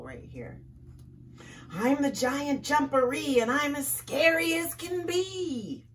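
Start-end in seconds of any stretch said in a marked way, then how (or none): 1.7-5.9 high, loud, put-on monster boast. Hidden creature scaring Rabbit from the burrow, loud showy boast. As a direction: boom it out to sound scary. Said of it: "loud voice coming from inside his burrow".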